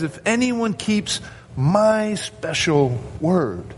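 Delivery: "my special word" drawn out slowly, pitched high; emphatic. Retold or imitated. Preacher voices Jesus prizing his word above law, emphatic. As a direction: set "my special word" apart, weighing each word. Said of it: "as though there's no other word that compares".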